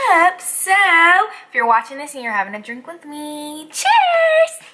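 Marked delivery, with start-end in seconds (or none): 3.7-4.6 excited cheers. Real, not acted. Toasting the camera with a drink.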